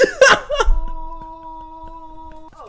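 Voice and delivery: laughing till you cry; helpless teary laugh; crying laughter. Real, not acted. Reacting to funny clip.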